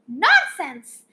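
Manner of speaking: a loud, indignant shout; loud prince's outburst. Acted. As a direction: bark out the prince's refusal. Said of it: "shouted the prince".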